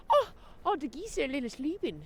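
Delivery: high, cutesy coo at the sleeping geese. Real, not acted. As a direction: talk to the geese like babies.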